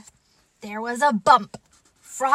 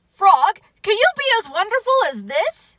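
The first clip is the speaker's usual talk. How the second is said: high, loud, rushed challenge; boastful excitement; 10 semitones higher, 7 dB louder, faster than usual. Acted. Dancing Toad showing off to Frog, excited and loud. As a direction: show off breezily in a fast high voice.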